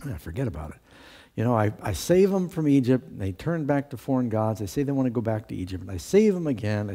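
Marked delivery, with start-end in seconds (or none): 0.0-0.8 lower, softer, fed-up sigh of a voice; a tired God shrugging them off, low and soft; weary God. Retold or imitated. Voicing God, weary of Israel.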